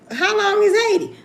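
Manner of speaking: high, alarmed asking; imitating her own high alarm; mock alarmed question. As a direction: ask it like it's the end of the world. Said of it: "I'm like".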